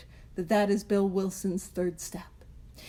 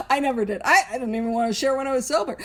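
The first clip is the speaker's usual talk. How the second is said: ordinary talk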